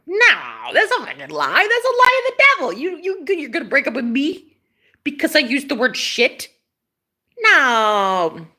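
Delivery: indignant disbelief, leaping up in pitch; overdramatic, scandalized objection; mock outrage. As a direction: protest in hammed-up disbelief. Acted outrage at being dumped.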